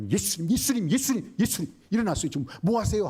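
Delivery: high, urgent repeated calling; panicked disciples. Acted. Disciples facing death waking Jesus, panicked calling. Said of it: "죽게 되니까".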